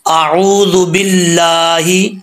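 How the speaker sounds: slow melodic tajweed chant; Quranic recitation. Acted. Teacher opening the lesson with a chanted tajweed recitation. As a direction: intone it slowly, long held endings.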